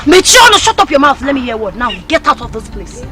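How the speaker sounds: normal talking voice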